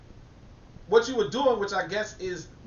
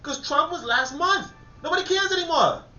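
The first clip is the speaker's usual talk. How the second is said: much higher, mock-dismissive quote; high mocking brush-off; 10 semitones higher than usual. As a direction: toss it off in a high put-on voice. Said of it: "And I'm like".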